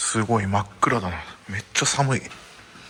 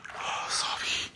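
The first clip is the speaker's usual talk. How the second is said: shivering whisper; 10 dB quieter, much slower than usual. Real, not acted. Shivering in the freezing open-air bath.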